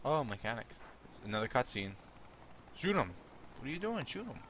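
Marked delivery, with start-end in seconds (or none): 2.8-4.4 high-pitched, pleading exasperation; exasperated urging. Real, not acted. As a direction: urge them on, voice pitched up. Urging game character to shoot.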